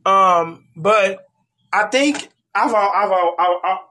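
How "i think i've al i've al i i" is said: stumbling, repeating the start; stuttering. Real, not acted. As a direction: trip over the words. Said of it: "why am I stuttering?".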